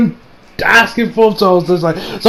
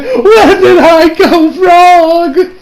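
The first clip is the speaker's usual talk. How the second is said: high, very slow, loud crying plea; mock tearful wail; 11 semitones higher, 6 dB louder, much slower than usual. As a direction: drag it out like a hammed-up sob. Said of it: "she's still tearing up".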